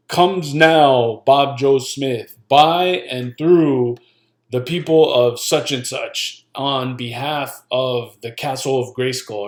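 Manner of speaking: drawn-out, mock-grand courtroom delivery; mock legalese. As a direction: intone it like stuffy legal jargon. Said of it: "Don't talk like this".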